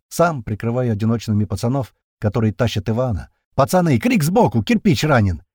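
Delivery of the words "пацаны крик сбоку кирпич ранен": raised, urgent cry; alarmed shout. A narrator's reading. Comrade reports Kirpich wounded, alarmed shout. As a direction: call the alarm to the men.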